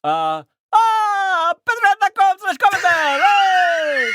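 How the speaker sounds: loud, very high cartoon-Italian accent. Shown as a demonstration.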